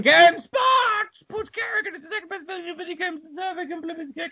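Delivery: high-pitched burst of reading; dramatic high reading. Real, not acted. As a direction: lift the voice way up for drama.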